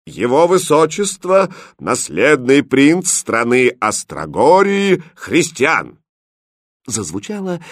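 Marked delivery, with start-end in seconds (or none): 0.1-6.0 loud, high, stately herald; solemn proclamation. Acted. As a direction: announce it grandly, drawing out the words. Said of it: "торжественно провозгласил". (торжественно провозглашая)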